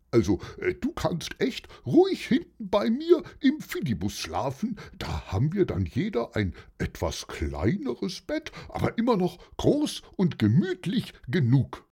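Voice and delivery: lightly voiced helper, pitch leaping up on key words; friendly helper character. Bus owner offers Ardalan half his bed, lightly acted friendly voice. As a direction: make a lively, reassuring offer, lightly in character.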